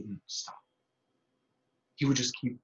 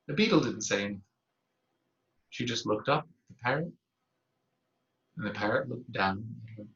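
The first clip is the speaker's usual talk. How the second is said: ordinary delivery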